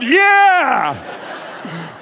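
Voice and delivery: put-on a childlike squeal of yeah; four-year-old's cheer. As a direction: cheer like an excited little kid. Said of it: "like a four-year-old going".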